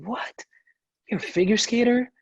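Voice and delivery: high, incredulous imitation of a stranger, half laughing; mock disbelief. Strangers learning he figure skates, hammed-up high disbelief. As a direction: ham up someone who can't believe it. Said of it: "it's such a surprise".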